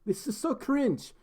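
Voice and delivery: high-pitched, hammed-up Australian accent; bad Aussie accent. Shown as a demonstration. Streamer thanks a donor on request, hammed-up Aussie accent. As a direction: do a cringey cartoon Aussie. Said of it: "your best, best Aussie accent".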